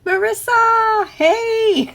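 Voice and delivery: high, loud, drawn-out delighted hello; excited greeting. Real, not acted. Host greeting a returning viewer, high delighted call.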